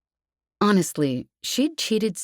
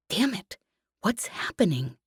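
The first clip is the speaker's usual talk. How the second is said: tense whisper; 5 semitones higher, 4 dB quieter than usual. A narrator's reading. Tense covert message mid-mission.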